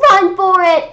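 loud, high yell; shouting. A narrator's reading. A J shouting to Ryan to flee, loud and high. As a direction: bellow it as you bolt. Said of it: "I shouted to Ryan".

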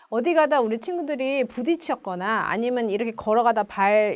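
normal talking voice

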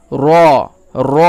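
র said full and heavy, lips rounded. Shown as a demonstration. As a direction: round the mouth and give র a thick, full sound. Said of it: "এরকম করে উচ্চারণ করতে হবে, মুখখানা গোল করে".

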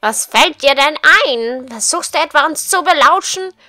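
scolding. Acted. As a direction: tell off an eavesdropper. Reads scolding ladies' lines. (empörtes Schimpfen)

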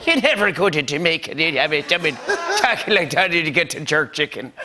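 lilting put-on Jamaican accent; hammy Irish-turned-Jamaican accent; mock Jamaican accent. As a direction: lean into a bad Jamaican accent. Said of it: "your Irish accent sounds more Jamaican to me".

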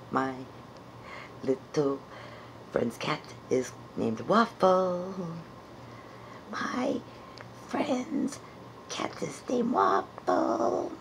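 normal talking voice

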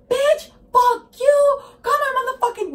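high, loud mock rant; imitated yelling. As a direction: act out a friend yelling in a rage. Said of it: "this bitch fucking starts yelling at me".

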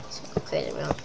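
whispered aside, no voice; whispering. Real, not acted. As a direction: breathe it out as a whisper. Repeats line, then whispers it.

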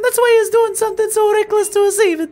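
very high put-on woman's voice, urgent; high female game character, earnest. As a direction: read her lines way up high.

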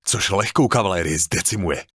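ordinary delivery

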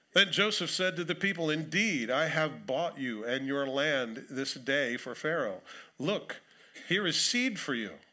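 solemn public reading of scripture. Acted. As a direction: read the passage out with weight. Reads scripture aloud.

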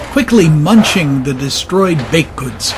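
plain reading voice

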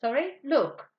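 normal talking voice

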